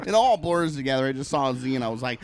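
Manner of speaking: normal talking voice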